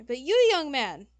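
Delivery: very high, loud diva outburst; scandalised designer, pitch soaring; outraged fashion designer. Acted. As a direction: shriek in theatrical horror at the fashion crime.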